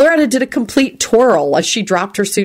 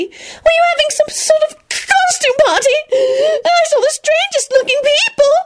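very high indignant voice; angry announcement. A narrator's reading. Loretta complaining to everyone, very high indignant voice. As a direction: announce it with offended anger. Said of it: "she announced angrily".

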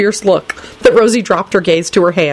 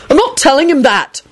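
higher, outraged shout; outraged yell; 10 semitones higher than usual. A narrator's reading. Neville refusing Lupin's errand, outraged higher yell. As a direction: yell it out, fed up and indignant. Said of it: "Neville yelled in an outraged voice".

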